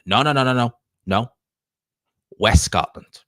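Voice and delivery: quick, loud string of retold denials; emphatic correction. Scottish woman correcting his guess at where she's from, emphatic.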